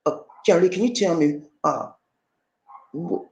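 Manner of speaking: high, hesitant voice of a soldier asking his general; a timid young soldier, stammering to his commander; pleading soldier. Retold or imitated. A soldier asks General Lee what to do, high and hesitant.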